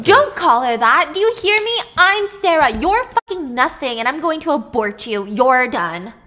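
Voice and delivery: furious. Retold or imitated. Sarah rages at her double.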